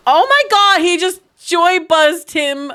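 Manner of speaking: high, loud, gleeful exclamation; shocked outburst. Real, not acted. Gamer seeing him joy buzz someone, shocked outburst. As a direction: burst out loudly in amazed shock.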